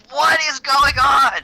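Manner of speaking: loud, high, slow mock yell; confused janitor's mock yell; mock shout. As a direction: bellow it in a hammed-up high voice. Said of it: "He's like".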